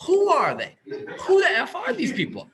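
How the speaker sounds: worked-up, exasperated rant; baffled outburst. Real, not acted. Baffled rant about unknown buyers.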